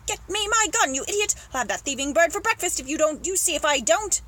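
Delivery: high shouted order easing into a threat; angry shouting. Acted. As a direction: shout at a useless servant. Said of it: "The Duke was shouting to the gardener".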